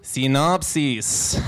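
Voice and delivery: put-on slow, deliberate Mexican accent. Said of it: "In a Mexican accent".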